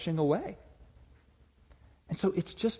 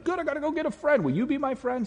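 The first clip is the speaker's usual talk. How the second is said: much higher, fast and eager; overeager friend-seeker; 12 semitones higher than usual. Retold or imitated. Churchgoer mocked as rushing to get friends, high and fast. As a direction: rush at it like you must grab a friend now. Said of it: "you might be like".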